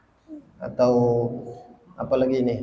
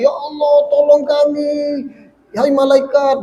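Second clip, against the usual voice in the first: high, loud mock wail for help; imitated pleading; 11 semitones higher, 5 dB louder, slower than usual. Preacher voices hell dwellers begging God and angels, imitated pleading. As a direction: call out like a desperate sufferer.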